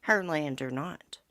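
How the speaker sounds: ordinary talk, nothing marked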